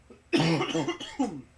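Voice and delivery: hard loud coughing fit; coughing on a drink. Real, not acted. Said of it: "kinda went down the wrong way".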